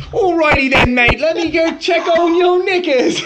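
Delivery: high, loud cartoonish British accent; Bert Kreischer. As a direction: belt out a silly British mate voice. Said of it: "so he did a British one".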